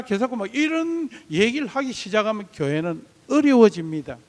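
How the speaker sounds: usual speaking voice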